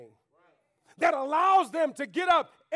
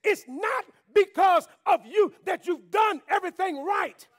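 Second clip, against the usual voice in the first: louder, higher, slow shouted preaching; full-force preaching; 5 semitones higher, 5 dB louder than usual. Acted. Pastor rousing the church about God's view, shouted sermon. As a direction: drive it home to the congregation.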